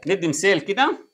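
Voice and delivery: usual speaking voice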